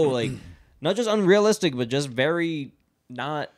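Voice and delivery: ordinary talk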